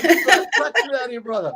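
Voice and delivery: high, laughing mock order; joking policeman. Policeman ribbing the driver about his little car, joking. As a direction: call it out through laughter. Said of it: "this big native policeman goes".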